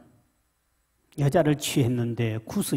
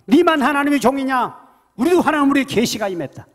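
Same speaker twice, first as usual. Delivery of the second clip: high, loud jealous retort; snapping at him; 11 semitones higher, 8 dB louder than usual. Acted. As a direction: fire it back at Moses. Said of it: "막 쏘아붙이기 시작합니다". (누나와 형이 모세에게 쏘아붙이는 큰 소리)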